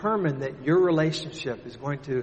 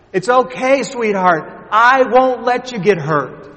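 higher, louder calm-down voice; reassuring father; 9 semitones higher, 12 dB louder than usual. Retold or imitated. Father calling up to his scared daughter, reassuring. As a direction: soothe her from below.

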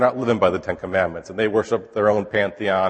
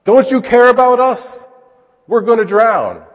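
high, loud, slow accusing cry; desperate accusation; 11 semitones higher, 7 dB louder, slower than usual. Pretend. Frightened disciples accusing sleeping Jesus, desperate loud cry. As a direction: hurl it at him in panic. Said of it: "That's pretty much what they're telling him".

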